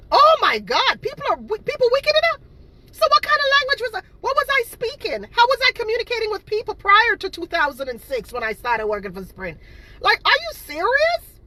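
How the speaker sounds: high, loud, incredulous outburst; outraged rant. Real, not acted. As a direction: blast the lie with disbelief. Ranting at haters' lies.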